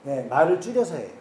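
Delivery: usual speaking voice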